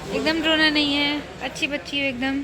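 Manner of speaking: calm her tears, promise help is near. Real, not acted. Consoling the crying girl again.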